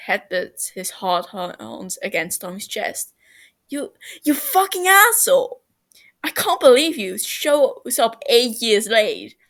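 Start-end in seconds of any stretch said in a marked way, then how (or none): 3.7-9.4 much higher, loud, cracking with emotion; furious relief at a long-lost friend; angry tearful yell. A narrator's reading. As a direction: yell it through tears. Reunion, furious at lateness.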